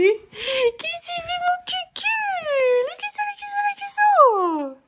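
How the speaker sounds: very high, slow singsong to a pet; high playful sing-song calling. Real, not acted. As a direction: coo it up high and stretched. Singsong baby talk to pet.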